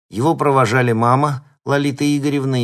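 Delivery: plain reading voice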